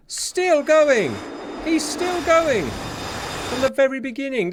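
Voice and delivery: astonished high exclamation; amazed. Real, not acted. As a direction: squeal in disbelief that he survives. Amazed at surviving enemy pilot.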